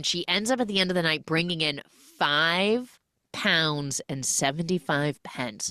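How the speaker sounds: normal talking voice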